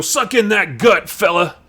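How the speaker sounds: barking orders, high and loud; hammed-up aggressive command; Nick Fury. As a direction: yell it like a gruff drill commander. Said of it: "He's always yelling and barking orders".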